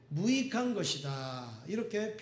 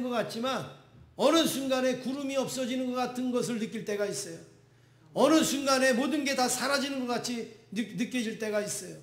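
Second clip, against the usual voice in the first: fervent preaching; 5 semitones higher, 4 dB louder, faster than usual. Acted. Pastor preaching at full force.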